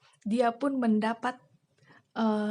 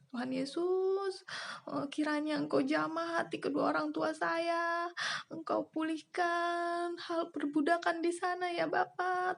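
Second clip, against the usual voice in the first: tearful prayer; 7 semitones higher, 4 dB quieter than usual. Real, not acted. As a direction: pray to Jesus while holding back crying. Praying for her parents.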